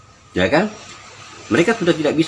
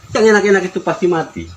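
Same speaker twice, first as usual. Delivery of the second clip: usual speaking voice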